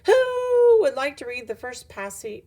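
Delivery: high, loud teacher with a drawn-out who; teacher calling on class. A narrator's reading. Missus Beam asks for a reader, high loud teacher call. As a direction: stretch the opening word out, high and loud.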